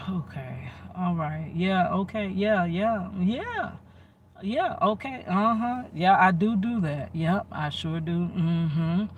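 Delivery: offhand string of humoring yeses; placating agreement. Retold or imitated. Humoring him as he baits an argument, placating tone. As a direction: agree along just to avoid the fight, mock-compliant.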